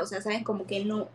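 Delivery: usual speaking voice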